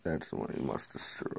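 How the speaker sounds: dramatic trailer whisper; ominous whisper. Acted. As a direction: hiss it like a movie villain secret. Trailer-style ominous line.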